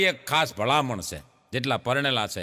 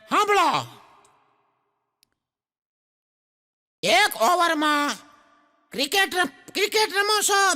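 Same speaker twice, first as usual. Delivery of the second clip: mock quizmaster; 12 semitones higher than usual. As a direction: draw out the question slyly. Challenger asks the riddle. (ઊંચા, ધીમા અવાજે ચાલાક પ્રશ્નકર્તા)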